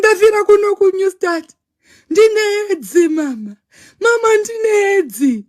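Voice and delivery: high, hammed-up tearful cry; dramatic wail. Daughter telling her mother her diagnosis, dramatic wail. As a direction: wail the retold news like a child to mama. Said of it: "dramatic like me".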